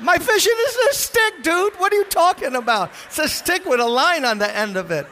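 very high, loud, outraged protest; indignant shout. Retold or imitated. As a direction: yell it at the ranger in disbelief. Retold outburst at the ranger.

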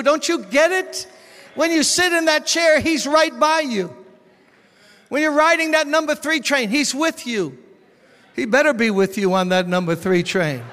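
loud, high, ringing sermon voice; fiery pulpit preaching; full-force preaching. Acted. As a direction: preach it out to the whole room.

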